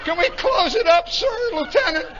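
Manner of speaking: high, cracking mock wail; panicked crewman. Johnson pleading to the lieutenant to close up, panicked wail.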